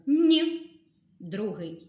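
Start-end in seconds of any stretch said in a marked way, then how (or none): 0.1-0.6 loud, clear «н» sound; isolated sound. Shown as a demonstration. Teacher sounding out Ніна's first sound, loud. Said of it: "Перший звук".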